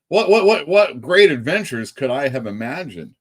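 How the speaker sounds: hammed-up eager wondering, high stuttered start; mock wonder. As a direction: gush in mock amazement, starting loud and high. Mockingly imagining absurd book's adventures.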